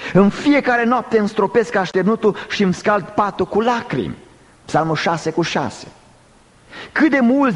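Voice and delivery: high, impassioned scripture declamation; forceful preaching. Real, not acted. Preacher quoting David's psalms to the congregation, forceful preaching.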